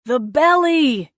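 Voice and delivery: slow, loud calling out; distant shout. Acted. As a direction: call it across the roaring wind. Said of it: "he shouted".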